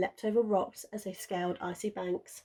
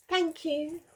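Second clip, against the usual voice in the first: thanking pup; 9 semitones higher than usual. A narrator's reading. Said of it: "Wilf waved".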